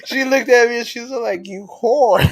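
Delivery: giggling through the story; laughing. Real, not acted. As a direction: laugh while telling it. Laughing while retelling story.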